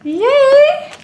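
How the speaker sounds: high bright yay; happy cheer. Real, not acted. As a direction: cheer with delight. Cheering the toy.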